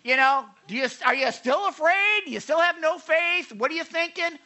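mock the disciples like a fed-up teacher; exasperated mock scolding. Shown as a demonstration. How Jesus did not scold his disciples, high and sarcastic. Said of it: "He's not saying".

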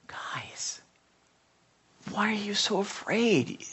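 soft whispered call, tender question; gentle, heartbroken. Retold or imitated. Jesus tenderly asking his disciples, hushed and gentle. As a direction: plead with them softly. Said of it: "I think he was like".

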